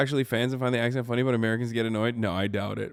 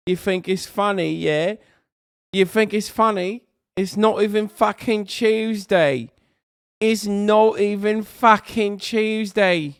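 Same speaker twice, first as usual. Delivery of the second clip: put-on higher, louder parody British accent; mock British outrage; 8 semitones higher, 6 dB louder than usual. Streamer as affronted Brit confronting a joker, mock British accent. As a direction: build into hammed-up British indignation.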